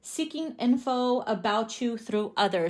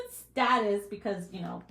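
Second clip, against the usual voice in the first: normal talking voice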